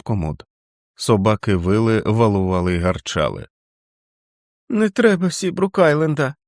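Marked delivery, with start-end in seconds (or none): 4.7-6.3 put-on high child's voice, mumbled; the feverish boy muttering in a nightmare; mumbling in sleep. A narrator's reading. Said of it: "бурмотів у вісні Річард".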